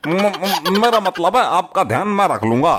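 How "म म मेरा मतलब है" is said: stuttering on the first sound; caught-out, stammered correction; flustered stammer. Acted. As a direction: stumble while hastily backtracking. Caught out, backtracks.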